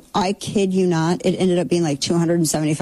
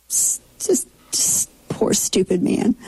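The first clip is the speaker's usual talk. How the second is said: whisper; 2 semitones higher than usual. Real, not acted. Pitying aside about the anchor.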